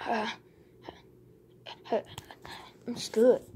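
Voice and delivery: whispered, voiceless; a sneaky pretend whisper. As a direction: breathe it without voice, sneaking.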